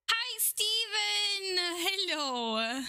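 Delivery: drawn-out, high bright hello; cheery greeting. Real, not acted. Greeting a viewer who joined the stream, drawn out. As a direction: stretch it out warmly.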